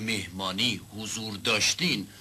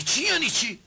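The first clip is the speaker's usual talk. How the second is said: exasperated; 12 semitones higher than usual. Acted. Judge exasperated repeat.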